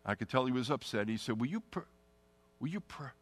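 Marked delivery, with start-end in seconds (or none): 1.4-3.1 stammering. Retold or imitated. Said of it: "he couldn't get the word out".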